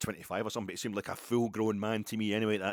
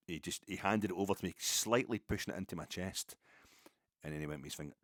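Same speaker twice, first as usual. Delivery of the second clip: usual speaking voice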